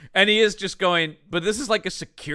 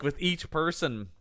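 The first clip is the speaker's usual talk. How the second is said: usual speaking voice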